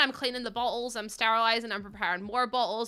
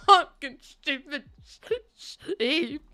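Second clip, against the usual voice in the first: slow, higher, loud words breaking with laughter; laughing curse; 5 semitones higher, 6 dB louder, much slower than usual. Retold or imitated. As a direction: curse the toy while cracking up. Laughing retelling of her cursing.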